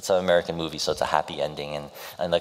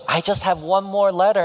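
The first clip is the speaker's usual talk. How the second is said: high, louder eager plea; hopeful hero voiced; 12 semitones higher, 5 dB louder than usual. Hero holding out a last letter to her, high and louder. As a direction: push the line up high and louder, pleading. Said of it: "and he goes".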